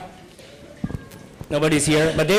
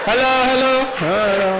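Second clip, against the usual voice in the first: put-on drunk passenger; 5 semitones higher, faster than usual. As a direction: ham up a tipsy guy pressing the call button. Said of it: "the Bangladeshi guy's already drunk".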